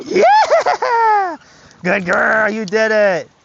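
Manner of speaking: high, loud cheer with a laugh; excited praise. Real, not acted. Trainer cheers his dog for crossing, laughing high praise. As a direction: celebrate the dog's success.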